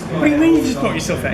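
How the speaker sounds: retold disbelief. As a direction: hurry the question out in disbelief. Retelling his disbelief at the crash.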